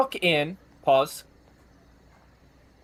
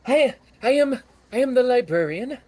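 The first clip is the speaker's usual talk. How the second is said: halfling librarian; 10 semitones higher, 5 dB louder than usual. Acted. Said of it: "He goes".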